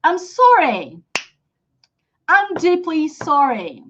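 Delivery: slow, clearly modelled phrases; repeat-after-me drill. Shown as a demonstration. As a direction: model each phrase slowly for students to repeat. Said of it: "So guys, repeat".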